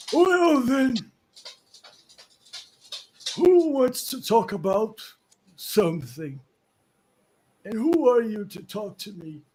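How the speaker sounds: put-on high, loud frail old man demanding who they are; cranky old man. Old man at the door challenging the party, suspicious.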